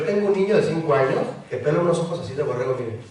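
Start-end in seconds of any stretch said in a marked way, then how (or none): none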